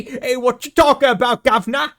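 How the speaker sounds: loud, high, hammed-up Cockney; Cockney accent. American imitating a Cockney street shout, mock Cockney accent. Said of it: "And then there's Cockney".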